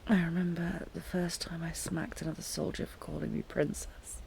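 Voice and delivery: ordinary talk, nothing marked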